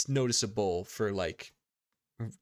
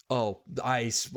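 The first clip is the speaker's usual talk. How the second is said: ordinary talk, nothing marked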